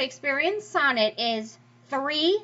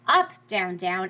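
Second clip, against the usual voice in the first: "up" punched, "down, down" falling lower; dactylic stress; 5 semitones lower, slower than usual. Shown as a demonstration. Teacher explaining dactylic meter, stress on first "up". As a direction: hit "up", then drop for two light "down"s. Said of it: "dactylic is the opposite".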